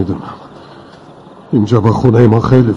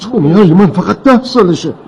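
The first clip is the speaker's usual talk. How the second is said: higher, indignant protest; 6 semitones higher than usual. Acted. Father defending his ten-year-old against blame, indignant. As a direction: defend your child, affronted.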